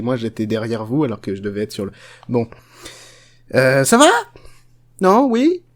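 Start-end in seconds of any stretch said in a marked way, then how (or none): none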